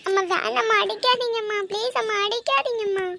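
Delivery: high, loud, panicked pleading; begging not to be hit. Acted. Daughter cries out to her mother to stop hitting, panicked. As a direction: cry out to make the blows stop.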